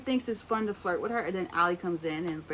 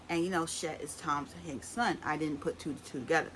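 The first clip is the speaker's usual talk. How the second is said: ordinary talk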